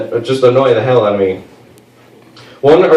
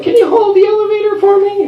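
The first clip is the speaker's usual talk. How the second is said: put-on much higher, sweet old lady asking a favour. Elderly neighbor asks him to hold the elevator, high sweet voice. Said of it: "she's like".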